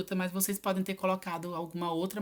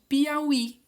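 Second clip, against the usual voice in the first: Piauí slow and loud, leaning on the final í; emphatic drawn-out Piauí, weight at the end; stress on last syllable; 7 semitones higher, 7 dB louder than usual. Shown as a demonstration. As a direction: stretch Piauí out to show the strong í. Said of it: "a última sílaba I é a que tem acento e a mais forte".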